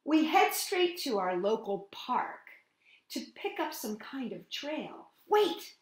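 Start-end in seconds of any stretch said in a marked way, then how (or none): none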